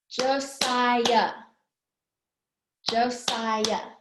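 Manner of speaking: JOSIAH in three even beats; syllable by syllable. Shown as a demonstration. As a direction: count out JO, SI, AH in JOSIAH. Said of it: "Josiah has three".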